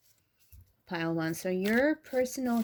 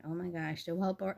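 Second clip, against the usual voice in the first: ordinary talk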